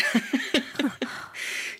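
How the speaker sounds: breathy laugh. Real, not acted. Laughs at listener's joke.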